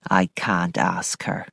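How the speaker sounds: low, soft, slow Mrs Grose; heavy-hearted housekeeper; sadly. A narrator's reading. Housekeeper grieving over Flora, low, soft and slow. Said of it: "Missus Grose said sadly".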